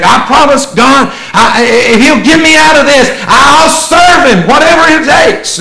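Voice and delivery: high, loud pleading vow; desperate promise. Retold or imitated. Preacher voicing a person bargaining with God in crisis. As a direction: beg God like a cornered man.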